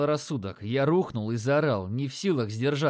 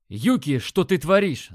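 shocked yell, higher and louder; 4 semitones higher, 6 dB louder than usual. Acted. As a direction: yell it out in alarm. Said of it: "заорал я".

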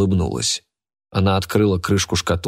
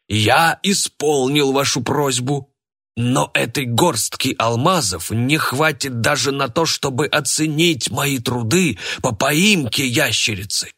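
indignant; 6 semitones higher, slower than usual. A narrator's reading. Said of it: "возмущённо сказал Сергей Иванович".

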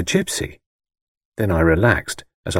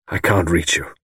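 lower-pitched, weary resignation; drained, defeated; 4 semitones lower than usual. A narrator's reading. Architect failing to get through to his friend, wearily. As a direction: let the vigour go out of it. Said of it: "as though the vigour went out of him".

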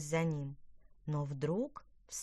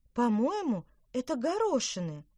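put-on higher, louder girlish voice, surprised; surprised guess; 7 semitones higher, 5 dB louder than usual. A narrator's reading. Girl tells brother her guess, surprised. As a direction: work it out with surprise. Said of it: "с удивлением предположила Лина".

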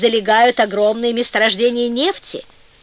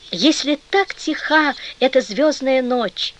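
plain reading voice

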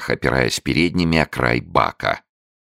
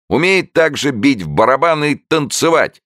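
high, loud clucking barker; cackling showman; 13 semitones higher, 4 dB louder than usual. A narrator's reading. Showman clucking about the newt's drumming, high loud cackle. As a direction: cluck it out like a hen, pitched way up. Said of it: "прокудахтал человечек".

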